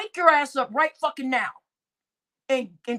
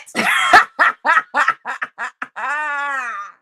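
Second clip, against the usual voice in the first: stunned laugh; 5 dB louder than usual. Real, not acted. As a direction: burst of disbelieving laughter. Laughs at the insult.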